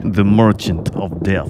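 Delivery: slow, low Russian accent; menacing Russian villain accent. Shown as a demonstration. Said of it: "Now do it in the Russian accent".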